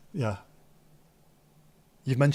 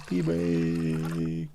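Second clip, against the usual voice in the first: much lower, drawn-out "P break"; deep announcer; 7 semitones lower than usual. Pretend. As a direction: boom "P break" like a jingle voice. Jingle-style P break.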